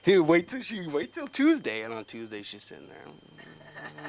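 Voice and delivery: ordinary talk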